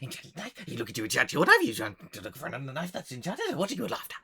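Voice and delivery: high, gabbling gnome shopkeeper; excited, eccentric gnome. Acted. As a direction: fire questions in a high gnome voice. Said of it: "excited and a little bit eccentric".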